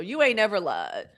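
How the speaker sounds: ordinary delivery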